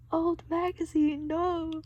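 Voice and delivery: high, mock scream of "Old magazine". A narrator's reading. Patrick fleeing the waiting room, high mock scream. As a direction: recoil from the magazine. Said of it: "Patrick screamed".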